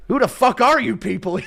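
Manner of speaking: put-on lost old man shouting at strangers; bewildered yell. Chandler at Christmas, shouting at his own family, bewildered. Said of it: "you know what I mean?".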